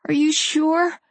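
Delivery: high, pleading question; a little pathetic. A narrator's reading. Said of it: "I sounded a little more pathetic than usual".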